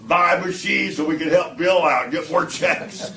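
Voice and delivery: loud, high-pitched mock sales plea; rallying call. [name] urges his friends to help Bill out, loud rallying call.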